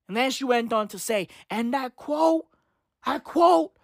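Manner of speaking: ordinary talk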